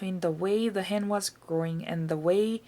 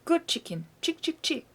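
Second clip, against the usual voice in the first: coaxing chicken call; 7 semitones higher than usual. A narrator's reading. Coaxing the hen.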